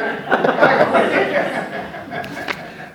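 long rising laugh; high breathy laugh. Real, not acted. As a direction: giggle up high. Laughs after quote.

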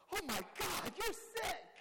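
high, loud squeal of disbelief; mock horrified shock. Newcomer reacting to his sponsor's story, mock horrified. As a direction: exclaim it in hammed-up horror.

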